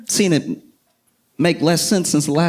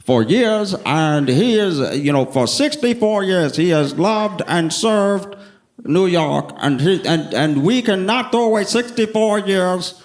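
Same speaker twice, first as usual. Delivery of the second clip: lightly imitated halting podium speaker, pitched up; earnest supporter speech; 4 semitones higher, slower than usual. Supporter addressing the press conference, lightly imitated higher speech voice. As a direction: stumble through a slightly high, mock-solemn endorsement. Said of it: "this guy gets up there and he goes".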